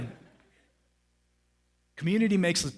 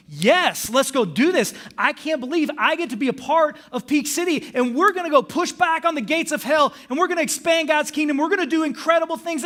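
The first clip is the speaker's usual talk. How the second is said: loud, high, amped-up cheer; fired-up believer ready to go; excited; 12 semitones higher, 9 dB louder than usual. Retold or imitated. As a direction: shout it like you just left church pumped. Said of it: "Like, I was excited".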